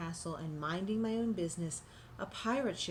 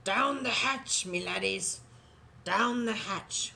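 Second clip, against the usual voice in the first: slow, louder roar of a pirate toast; pirate yell; 4 dB louder, slower than usual. Acted. Captain yelling a toast at dinner. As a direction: bellow it with a fist on the table. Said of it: "pounded his fist on the table and yelled".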